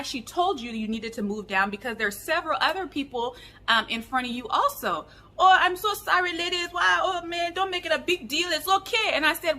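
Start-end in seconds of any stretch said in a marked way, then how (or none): 5.4-9.1 high-pitched imitation of a Nigerian man; Nigerian accent. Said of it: "do my best Nigerian accent".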